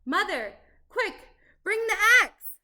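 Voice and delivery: high loud panicked shout; urgent yell. Acted. Jack shouting to his mother to bring the axe, high and loud.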